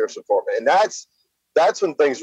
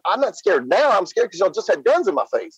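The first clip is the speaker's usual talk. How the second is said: higher-pitched, insistent retort; agitated retold protest; 7 semitones higher than usual. Boy answering police after guns in his face, agitated protest. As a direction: protest in a high, swooping voice.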